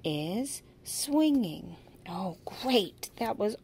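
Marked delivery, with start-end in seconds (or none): 0.0-1.8 "is swinging" drawn out slowly, stretching swinging; slow modeling. Shown as a demonstration. Teacher modeling the sentence for a young learner, slow. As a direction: spell each word out slowly for the child. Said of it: "show them how to use".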